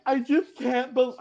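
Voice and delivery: high, slow mocking whine of Bowie Jane; imitating Bowie Jane. Host mocks a blindsided houseguest, high slow whine. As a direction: squeak it out like a disbelieving houseguest. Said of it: "She is like".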